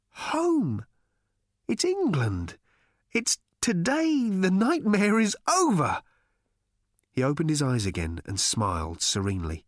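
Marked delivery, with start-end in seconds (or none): 0.1-6.0 higher, elated and relieved; rejoice, the nightmare's over; overjoyed. A narrator's reading. Arthur overjoyed at home.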